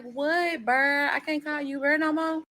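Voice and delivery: high, teasing ex voice; ex-fiancée teasing the boyfriend, high and mock-innocent. Ex voiced mocking.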